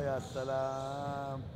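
slow, stretched-out "ya salaam"; drawn-out exclaim. Real, not acted. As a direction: savour the moment, drawing it out. Commentator marvels at a play.

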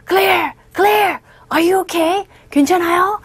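badly faked concern; badly acted worry. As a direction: ham up a concerned call, not quite convincing. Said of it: "참 연기 못하죠".

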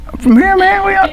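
high-pitched, mildly mock-offended comeback; mock indignant retort. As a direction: act out baffled protest at being taken for an outsider. Acting out his reply to locals.

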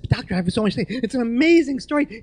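loud, high, bursting objection; excited protest. Retold or imitated. Patient to doctor ordering silence, loud excited protest. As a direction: burst out pleading to be allowed to talk.

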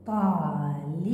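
طالب drawn out slowly with a rising end; slow teaching read of طالب; slow blending. Shown as a demonstration. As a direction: stretch طالب out sound by sound. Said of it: "لي،".

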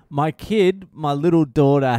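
ordinary talk, nothing marked

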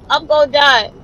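ordinary talk

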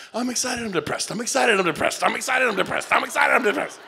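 mock agent flipping moods over and over; mood swings. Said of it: "Here's your life in real estate".

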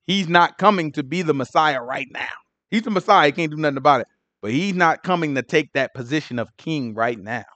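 normal talking voice

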